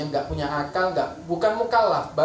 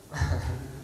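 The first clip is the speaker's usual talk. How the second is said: low, soft chuckle; short chuckle; 6 semitones lower, 6 dB quieter than usual. Real, not acted. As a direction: chuckle briefly to yourself. Preacher chuckles at own remark.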